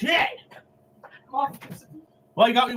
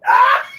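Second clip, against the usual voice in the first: very loud scream; 10 dB louder than usual. Real, not acted. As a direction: scream as loud as you can. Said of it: "Couldn't be louder".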